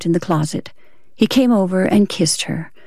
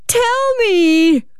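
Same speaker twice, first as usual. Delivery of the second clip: very high, drawn-out cry; furious demand; 13 semitones higher, 3 dB louder than usual. Acted. Wife cutting off her husband's excuse, furious and high. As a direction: cut in with a high, piercing demand.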